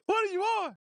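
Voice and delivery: high, loud, incredulous; exasperated disbelief. Retold or imitated. Streamer repeating his jab at Mabry, high and loud disbelief. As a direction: squeal it out in mock outrage. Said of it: "I'm like".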